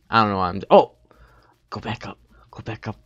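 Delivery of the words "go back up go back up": whispered urging, no voice. Real, not acted. Gamer whispering to his avatar, hushed and voiceless. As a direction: breathe it out, urging the character on. Urging own character in game.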